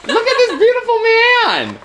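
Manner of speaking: high, swooning gush over the poster. Real, not acted. Fan showing off a Hades poster to listeners, swooning gush. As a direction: swoon over him like a fangirl.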